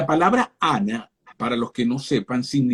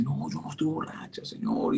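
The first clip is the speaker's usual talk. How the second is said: hushed denial; 12 dB quieter than usual. Acted. Ana answers the accusing priest.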